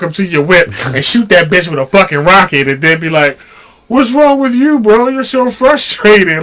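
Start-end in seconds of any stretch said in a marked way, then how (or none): 3.9-6.4 higher, mock-puzzled needling; imitating a taunting gamer. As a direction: needle them with fake concern. Voices game players taunting.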